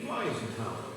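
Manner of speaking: plain reading voice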